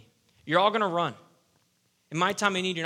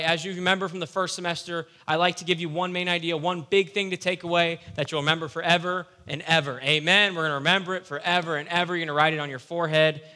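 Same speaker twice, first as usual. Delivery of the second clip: ordinary talk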